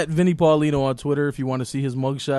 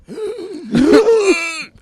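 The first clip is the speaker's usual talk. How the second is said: mock gasp; 12 semitones higher, 3 dB louder than usual. Mocking old grumpy host.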